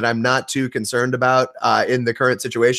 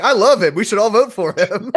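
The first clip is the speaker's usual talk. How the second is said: mock gushing fan; 8 semitones higher, 3 dB louder than usual. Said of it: "Austin's in the background, like".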